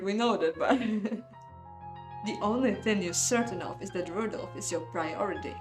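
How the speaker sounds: ordinary delivery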